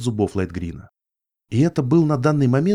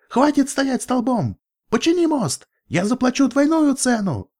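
much higher, desperate yelling; 13 semitones higher than usual. Acted. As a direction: bellow orders in panic. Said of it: "завопил единорог".

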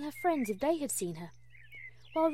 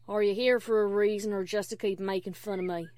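low, unamused country voice; annoyed; 4 semitones lower, 4 dB louder than usual. Acted. Applejack fed up with Dash mocking her, annoyed. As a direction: challenge the teaser, dry and low.

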